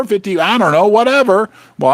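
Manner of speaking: ordinary talk, nothing marked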